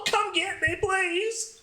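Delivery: imitating her drunk, high and laughing; mock the tipsy friend begging, way up high; high drunk girl voice. Drunk friend voiced in phone story.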